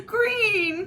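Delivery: put-on a high childish wail of protest. A narrator's reading. Little sister wailing about ugly green shoes. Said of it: "Kirsty wailed".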